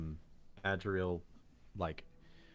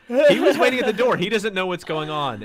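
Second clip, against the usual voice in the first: loud, high, urgent yell; panicked shout from the doorway; npc shout; 13 semitones higher, 13 dB louder than usual. Acted. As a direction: bellow it at the party in alarm.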